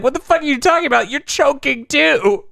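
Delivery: choking outrage. Retold or imitated. Quoting choking character.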